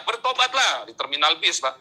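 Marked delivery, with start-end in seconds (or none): 0.0-0.7 high-pitched mock call to the crowd; retold street-preacher cry. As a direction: call it out like a bus-terminal evangelist. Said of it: "saya teriak-teriak". (tiruan seruan penginjil, bernada tinggi)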